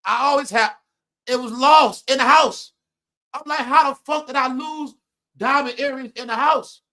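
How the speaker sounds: can't believe it's gone; baffled outrage. Retold or imitated. Retelling losing his diamond earring at home, loud and baffled.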